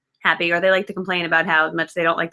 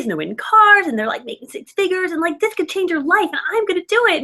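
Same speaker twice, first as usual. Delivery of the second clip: excited rising pitch; 8 semitones higher than usual. Retold or imitated. Excitedly telling mom on phone.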